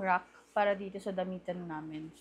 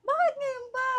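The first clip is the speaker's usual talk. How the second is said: high, loud whine of protest; exclaimed, high-pitched objection; 6 dB louder than usual. Real, not acted. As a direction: complain loudly in a raised pitch. Protests about early birthday gift.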